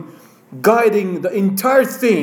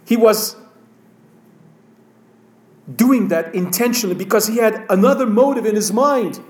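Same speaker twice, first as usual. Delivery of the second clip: ordinary delivery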